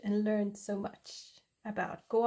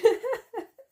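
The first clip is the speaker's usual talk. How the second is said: a loud, high burst of giggles; high laugh; 11 semitones higher, 7 dB louder than usual. Real, not acted. Admitting she talks rubbish, high laugh. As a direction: break into giggles.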